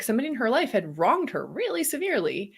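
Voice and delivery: usual speaking voice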